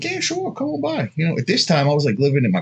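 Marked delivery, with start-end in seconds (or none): 0.0-1.1 casual invite. Retold or imitated. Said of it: "I'm like".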